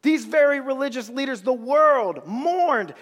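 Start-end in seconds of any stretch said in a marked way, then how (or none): none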